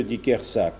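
usual speaking voice

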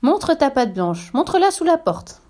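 high, piping little-goat voices; eager high child voice; kids calling out. A narrator's reading. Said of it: "crient les biquets".

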